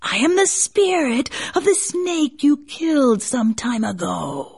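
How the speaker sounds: high, eerie snake spirit voice; menacing serpent, waiting for revenge; basilisk. A narrator's reading.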